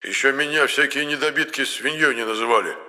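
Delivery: put-on high, huffy old man; offended old man. A narrator's reading. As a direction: bristle with offense. (обиженный старик)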